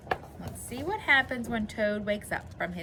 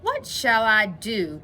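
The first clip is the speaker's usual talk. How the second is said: slow, louder storybook wail; mild cry of dismay; 3 semitones higher, 7 dB louder, slower than usual. A narrator's reading. Toad despairing over seeds that won't grow, mildly crying out. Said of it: "cried Toad".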